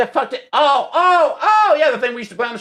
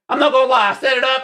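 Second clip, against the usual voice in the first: normal talking voice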